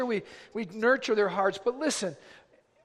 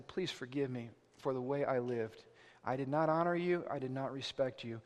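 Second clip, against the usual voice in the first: low, softened plea for forgiveness; humble apology; 8 semitones lower, 7 dB quieter than usual. Acted. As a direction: lower and soften it, confessing humbly. Models a humble apology to parents.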